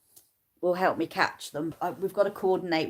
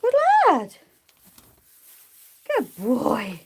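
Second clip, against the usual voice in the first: very high, louder praise; 4 semitones higher, 4 dB louder, slower than usual. Real, not acted. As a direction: cheer him on. Praising the sheep.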